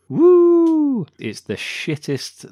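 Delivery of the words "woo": high, loud hammed-up whoop; imitating a cartoonish cheer; mock joyful whoop. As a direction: whoop it like a cheesy flying sleigh moment. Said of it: "And then they go".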